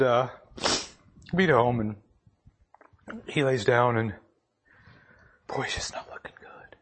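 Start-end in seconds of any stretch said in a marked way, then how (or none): 5.5-6.7 breathy unvoiced whisper, much softer. Real, not acted. Telling the congregation his dying dog looks bad, in a whisper. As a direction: hush it out as if the dog can't bear noise.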